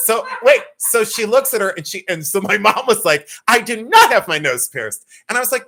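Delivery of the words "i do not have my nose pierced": higher, louder imitation of indignant mom, leaning on "not"; emphatic mock denial. Mom denies the piercing again, imitated indignation. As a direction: deny it hotly as the mom, hitting "not".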